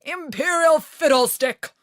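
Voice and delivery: loud, huffy king. A narrator's reading. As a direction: snap back at the queen, loud. The King answers.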